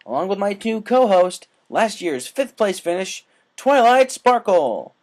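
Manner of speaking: excited dragon announcer; race announcer. Acted. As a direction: boom it out over the crowd. Spike announcing again.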